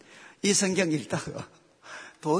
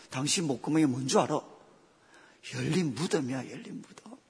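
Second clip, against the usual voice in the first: wife to husband; 6 semitones lower, 5 dB quieter than usual. Pretend. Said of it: "여자분들 남편한테 한번 이야기해 보십시오".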